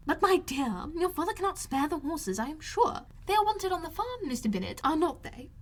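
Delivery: high, agitated mother voice; high fretful matron protesting; fussy Mrs Bennet. A narrator's reading. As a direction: play the indignant mother, pitched well up.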